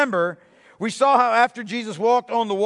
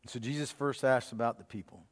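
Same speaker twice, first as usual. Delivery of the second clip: much softer, lower, intimate preaching; hushed sermon reading; 12 semitones lower, 13 dB quieter than usual. Acted. Preacher drawing his congregation into the scene, hushed. Hushed retelling of Mark's account.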